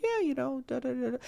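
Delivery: quiet flat mumble. Shown as a demonstration. Demo of the chill side, soft mumble. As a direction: tune it down to a bored murmur. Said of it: "the next minute you could be like".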